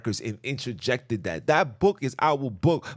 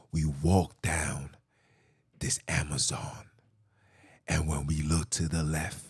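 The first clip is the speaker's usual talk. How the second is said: mock hushed narration; 10 semitones lower, 4 dB quieter than usual. Mock explorer story of Amazon discovery, whispered low.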